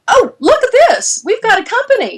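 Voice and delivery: very high, gushing mock squeal; retold excitement. Founder looking at her new company, retold giddy excitement. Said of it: "we're so excited".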